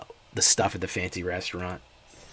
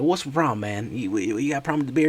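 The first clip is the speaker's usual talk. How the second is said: Alabama guy confronting; 5 semitones higher, 4 dB louder, faster than usual. Retold or imitated. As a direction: square up to the customer. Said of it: "the guy comes out".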